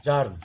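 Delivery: জার্ম said with a clear জ onset, falling; teacher models জার্ম; জ sound. Shown as a demonstration. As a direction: demo of জার্ম with জ. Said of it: "জি এর উচ্চারণটা বর্গীয় জ আসছে".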